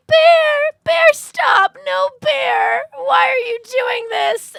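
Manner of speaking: high, loud panicked screaming, slowing at the end; screaming at bear. Retold or imitated. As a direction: shriek at the bear in panic, half crying. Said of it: "she's just screaming".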